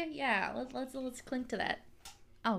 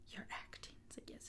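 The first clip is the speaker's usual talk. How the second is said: whispering; 19 dB quieter than usual. Real, not acted. Whispered aside about acting.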